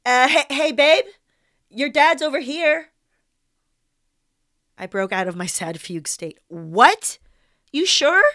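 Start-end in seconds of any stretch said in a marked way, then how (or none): 0.1-2.9 high, loud call to someone far away; yelling across a lawn. Retold or imitated. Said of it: "I hear Corey yell out". 6.5-8.2 loud, high burst of disbelief; startled shout. Retold or imitated. Startled reply to Corey's shout.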